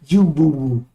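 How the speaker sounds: slow, put-on sappy voice; mocking voice. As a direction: parody a convert announcing his name. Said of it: "why you right here talking about".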